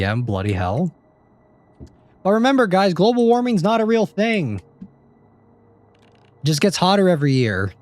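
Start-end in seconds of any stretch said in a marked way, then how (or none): 2.2-7.7 squeaky high voice, joking sarcasm; high mock sarcasm. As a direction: pitch way up and play it ironic. Sarcastic joke about global warming.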